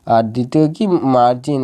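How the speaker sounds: ordinary talk, nothing marked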